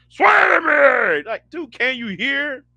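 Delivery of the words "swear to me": laughing into a slow, loud, high yell; mock roar that cracks into a laugh; laughing Batman shout. Streamer repeats Bale's Batman line, laughing shout. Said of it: "what Bale's Batman was saying".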